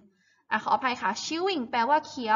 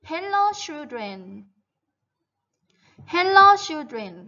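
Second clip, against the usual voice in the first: slow, drawn-out teacher diction; model reading for repetition; 4 semitones higher, 6 dB louder, much slower than usual. Shown as a demonstration. Teacher has pupils repeat a greeting after her, slow model reading. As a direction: say each word slowly for pupils to copy. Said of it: "repeat after me".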